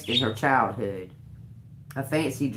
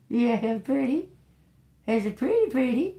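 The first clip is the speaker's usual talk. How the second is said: very high coaxing to the bird; 11 semitones higher than usual. Pretend. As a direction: coax it high and sweet.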